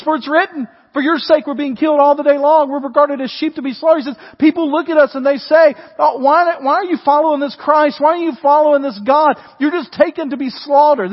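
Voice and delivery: loud sermon delivery; full-force preaching. Acted. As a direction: proclaim it to the room. Preaching scripture and scoffers' words.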